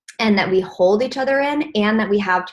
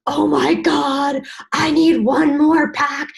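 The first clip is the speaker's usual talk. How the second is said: higher, louder, slowed, frantic worrying; panicked stressing; 7 semitones higher, 5 dB louder than usual. Retold or imitated. Coach imitating a stressed seller freaking out, high and loud. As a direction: voice mounting panic over sales targets. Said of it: "So if you're like".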